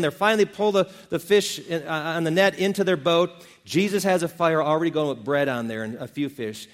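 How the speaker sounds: ordinary talk, nothing marked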